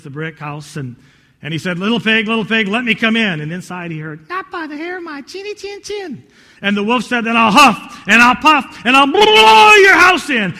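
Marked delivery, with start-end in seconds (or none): 1.8-3.4 loud storybook wolf call; big bad wolf. Wolf calls at third house. 4.3-6.2 very high squeaky piglet voice; little pig. Acted. Pig answers from inside. 7.2-10.5 booming, raised wolf threat; big bad wolf.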